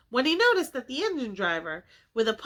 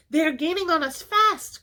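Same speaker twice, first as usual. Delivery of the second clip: alarmed cry; 7 semitones higher, 4 dB louder than usual. A narrator's reading. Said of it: "cried the engine driver".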